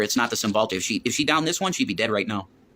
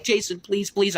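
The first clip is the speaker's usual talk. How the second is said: higher-pitched mock begging; imitated pleading woman; 8 semitones higher, slower than usual. Man retelling her morning plea, higher imitated begging. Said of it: "she was like".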